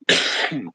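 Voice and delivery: one chesty cough. Real, not acted. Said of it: "Sorry for coughing in your face".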